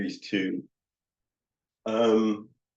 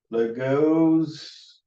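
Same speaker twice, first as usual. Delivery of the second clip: "Legos" higher and drawn out, rising; questioning tune; 5 semitones higher than usual. Shown as a demonstration. Counselor showing how it becomes a question, "Legos" rising. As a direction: stretch "Legos" upward into a question. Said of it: "it comes across as a question".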